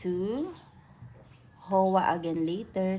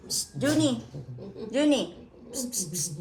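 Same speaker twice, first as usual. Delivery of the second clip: calling to the pet, pitched up; 2 semitones higher than usual. Real, not acted. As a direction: coax the cat over to you. Calling the cat by name.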